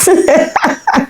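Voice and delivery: a quick high burst of laughter. Real, not acted. Mother laughing at having starved her baby, high laugh. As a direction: laugh at your own mistake.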